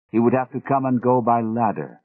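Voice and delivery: ordinary delivery, nothing marked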